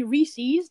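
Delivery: "Reese's" as REE-seez, long ee ending; rival pronunciation. Shown as a demonstration. Arguing from the apostrophe, rejected REE-seez. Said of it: "If it were to be".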